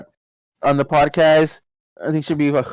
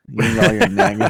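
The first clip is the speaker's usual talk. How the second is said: teasing the cat; 7 semitones higher than usual. Retold or imitated. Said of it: "I always tell Stinky".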